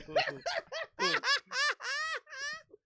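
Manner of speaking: high, loud laughing fit. Real, not acted. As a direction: burst out laughing. Laughs at visa delay.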